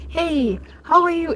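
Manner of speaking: high, breezy mock kid greeting; imitating kids. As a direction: chirp it up high like a cheeky kid. Imitating casual kids greeting elders.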